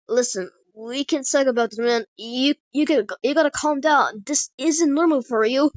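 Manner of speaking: urgent plea. Acted. Pleading with an enraged friend to calm down, urgent and loud.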